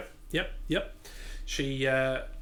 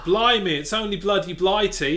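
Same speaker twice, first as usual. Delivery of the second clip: higher, louder hammed-up old-fashioned English; mock old English exclamation; 7 semitones higher, 8 dB louder than usual. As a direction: shout it like a wartime Brit. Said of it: "they also said Blimey a lot".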